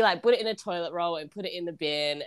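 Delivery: usual speaking voice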